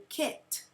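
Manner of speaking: "kit" as a separate syllable, the ket of basket; short E. Shown as a demonstration. Teacher sounding out the second syllable, short vowel in "kit". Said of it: "a short E".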